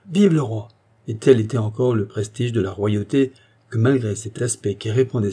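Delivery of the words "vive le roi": high, ringing loyal cheer; cried acclamation. A narrator's reading. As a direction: cry it out with raised pitch. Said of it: "Isidore de Charny cria". (acclamation criée, voix haute)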